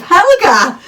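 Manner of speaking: high, loud startled cry of a name; surprised cry. Retold or imitated. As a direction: call out the name in sudden recognition. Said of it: "My involuntary cry of".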